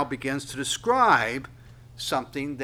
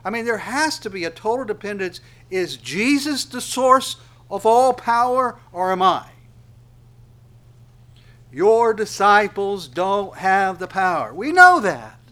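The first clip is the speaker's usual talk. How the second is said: preaching at full force; 6 semitones higher, 9 dB louder than usual. Acted. Preacher challenging the congregation about power, much louder. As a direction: call it out like the sermon's peak.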